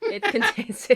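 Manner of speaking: high giggly laughter through words; giggling. Real, not acted. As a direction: laugh while trying to speak. Giggling over the sixty-nine joke.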